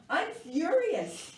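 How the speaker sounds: loud, high, drawn-out storybook fury; furious declaration. A narrator's reading. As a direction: stretch it out in acted anger. Sulking girl declares her anger.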